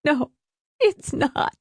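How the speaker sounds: very high, tearful voice; high, halting sobbed protest; weeping. A narrator's reading. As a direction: weep through the words. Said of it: "she wept".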